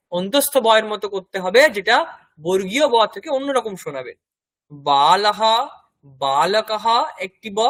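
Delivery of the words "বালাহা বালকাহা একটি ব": বালকাহা said slowly, a hard lip b and the ending breathed out as -aha; slow, with a hard b and an echoed -ha; Sanskrit visarga. Shown as a demonstration. Teacher contrasting the two b sounds, slow demo. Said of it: "ব যেটা বর্গীয়".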